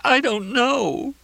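very high, drawn-out wail; weeping. A narrator's reading. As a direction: wail it helplessly through tears. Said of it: "Crystal wept".

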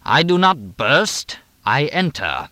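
slow, high, ringing grandeur; regal. A narrator's reading. Mooney corrects Edith from the living room, regal and ringing. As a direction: proclaim it like stage royalty. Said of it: "Mooney's voice rang regally from the living room".

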